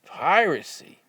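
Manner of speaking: disbelieving, half-laughing repeat of Piracy?; incredulous echo. Retold or imitated. Boys echo the trooper's charge: Piracy? in loud, high disbelief. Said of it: "And we said".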